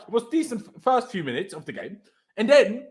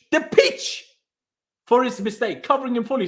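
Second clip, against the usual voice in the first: ordinary delivery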